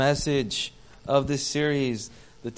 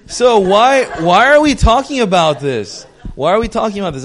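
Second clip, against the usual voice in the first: emphatic call; 8 semitones higher, 11 dB louder than usual. Real, not acted. Preacher calls out to the congregation.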